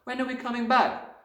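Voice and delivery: put-on high-pitched childish plea; anxious cry. A narrator's reading. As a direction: press the question like a worried little girl. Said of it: "Matilda cried".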